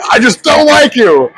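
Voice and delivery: high-pitched, playful exasperation; mock exasperated dislike. Fan confronting a wrestling villain on the plane, mock aggrieved.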